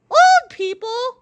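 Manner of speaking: high, slow, loud whine; whining old man. A narrator's reading. Old George whines the same words again, slow high whine. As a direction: drag it out like a complaining grandpa. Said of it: "whined old George".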